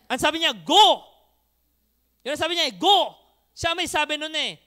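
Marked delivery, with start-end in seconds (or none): 0.6-1.0 a single loud "Go!"; commanding shout. Retold or imitated. The Lord ordering believers out, a loud command. 2.7-3.1 commanding shout. Retold or imitated. The Lord's command repeated.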